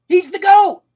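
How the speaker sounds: high, loud proud yell; excited shout. Real, not acted. Crowning Eren the best with a high, loud yell. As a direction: shout it like a fan at a stadium.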